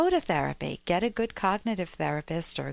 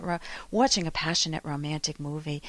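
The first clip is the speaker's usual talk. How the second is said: usual speaking voice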